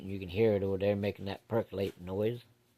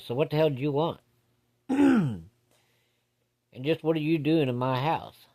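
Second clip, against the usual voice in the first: imitating a dad challenging an intruder, higher pitch; a pointed, higher-pitched retold demand; confrontational; 5 semitones higher than usual.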